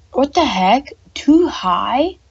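exasperated outcry at the height; shocked. Real, not acted. Coaster built too high.

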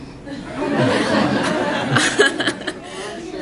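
long run of breathy laughter; amused laughter. Real, not acted. Presenter reacts to the audience's vote, laughing. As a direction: laugh along with the room.

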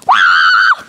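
deep fighting yell. Acted. Big fighting shout.

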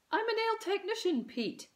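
high, scornful mum; scornful correction. A narrator's reading. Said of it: "corrected Mum scornfully".

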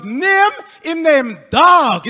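slow, loud Jamaican patois; Jamaican cousin. Retold or imitated. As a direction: drawl it like the deadpan cousin.

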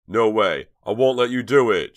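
full scream, high and loud. A narrator's reading. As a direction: brother screaming to stop his sister. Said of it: "Chuck screamed".

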